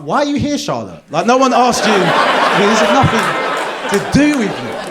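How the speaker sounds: high, loud comic indignation; exasperated outburst. Acted. Telling off the meddling friend, exasperated and loud.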